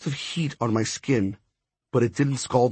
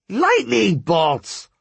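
high, drawn-out exclamation; booming outburst; 9 semitones higher, 4 dB louder, much slower than usual. A narrator's reading. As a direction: proclaim it slowly, high-pitched and forceful. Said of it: "Her voice rose so loud it shook the stone walls".

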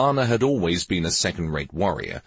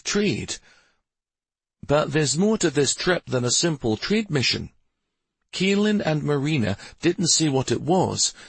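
lightly voiced wife, pitched high; earnest reassuring wife; 8 semitones higher than usual. A narrator's reading. Wife telling her husband he is needed, earnestly reassuring. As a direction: reassure him in a slightly put-on higher voice.

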